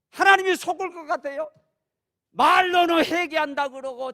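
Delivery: high, loud, driving pulpit voice, pressing each warning harder; fiery preaching. Acted. Preacher warning the congregation about God's wrath, full-force shouted preaching. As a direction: thunder it from the pulpit like God's anger is about to break.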